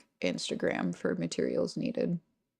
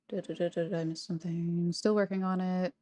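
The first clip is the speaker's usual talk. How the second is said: ordinary delivery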